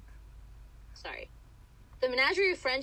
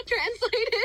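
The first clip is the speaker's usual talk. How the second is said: laughing; 5 dB louder than usual. Real, not acted. Laughing at French name.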